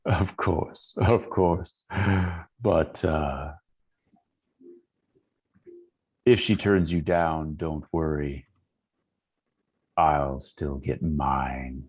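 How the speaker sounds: low, insinuating inner voice; voice in the head. Acted. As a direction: speak low, slowing to a drawn-out finish. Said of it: "And then the voice fades".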